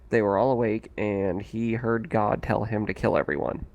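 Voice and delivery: usual speaking voice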